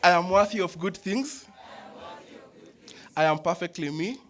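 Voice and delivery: loud, full-voiced affirmations; proud chanted declarations with energy; energetic shout. Real, not acted. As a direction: belt each line out with oomph. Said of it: "say it with some oomph, with some energy".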